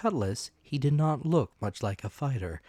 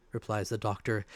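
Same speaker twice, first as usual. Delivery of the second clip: ordinary delivery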